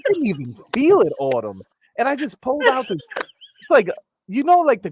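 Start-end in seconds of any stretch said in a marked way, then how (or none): 0.1-1.6 high, fast disbelieving voice; incredulous. Retold or imitated. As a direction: sound stunned and baffled. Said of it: "and I'm like".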